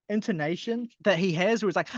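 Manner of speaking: ordinary talk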